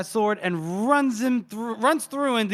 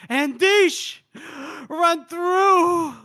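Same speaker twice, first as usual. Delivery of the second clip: higher, louder hammed-up wail; mock anguished cry; 11 semitones higher, 4 dB louder, much slower than usual. Casca over the body, crying out in mock grief. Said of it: "And then Costco goes".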